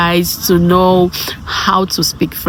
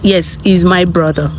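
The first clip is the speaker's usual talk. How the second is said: ordinary talk, nothing marked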